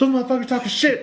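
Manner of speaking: high, laughing imitation of the wife; mock angry wife. Wife cursing the New Yorkers, laughing high imitation. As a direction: ham up the wife losing it.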